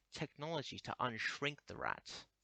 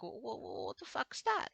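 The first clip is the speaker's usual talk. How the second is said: mock confusion; 11 semitones higher than usual. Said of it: "they're gonna be so confused by it".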